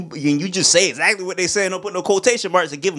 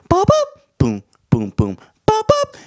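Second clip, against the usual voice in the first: jazz scat imitation; 3 dB louder than usual. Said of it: "Harlem Renaissance jazz club".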